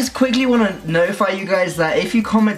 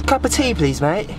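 British accent; 3 semitones higher than usual. Shown as a demonstration. Vlogger showing partner what to say, bright mock British. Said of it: "British accent?".

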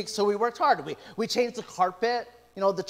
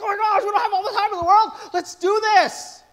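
high, loud, rushed rallying cry; urgent excitement; 12 semitones higher, 8 dB louder, faster than usual. Retold or imitated. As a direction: fire up the team against the clock. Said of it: "We're like".

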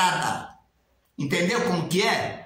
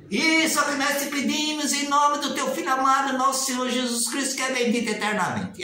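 higher, solemn prayer close; rote prayer ending; 6 semitones higher than usual. Retold or imitated. Critic mimicking the memorised ending of congregation prayers, solemn and raised. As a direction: intone the fixed closing formula. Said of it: "e o final".

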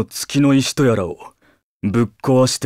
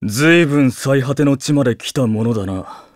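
ordinary talk, nothing marked

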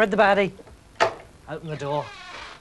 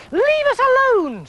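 put-on much higher, louder old lady voice; indignant granny shooing him off; little old lady; 5 dB louder, slower than usual. A narrator's reading. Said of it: "said the little old ladies".

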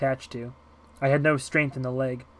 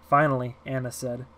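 usual speaking voice

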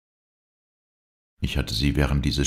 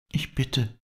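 breathed; 12 semitones higher than usual. Acted. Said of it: "hauchte sie".

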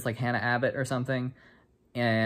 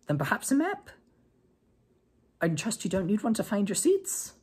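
much higher, crisp sarcastic put-down; stern teacher retort; 10 semitones higher than usual. Acted. McGonagall scolds late boys, high and sarcastic. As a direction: cut them down dryly.

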